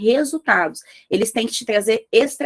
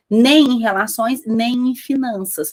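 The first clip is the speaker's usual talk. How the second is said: slow emphasis; 3 dB louder, slower than usual. Real, not acted. Slow emphatic warning list.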